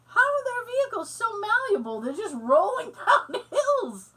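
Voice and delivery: high, amazed disbelief; incredulous. Retold or imitated. Baffled by rolling Russian cars, high and amazed. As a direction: ask it like you can't believe it. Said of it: "I'm like".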